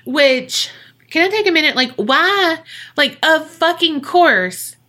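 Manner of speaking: high, exasperated outburst. Real, not acted. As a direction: protest in a high, incredulous voice. Outraged aside at the story.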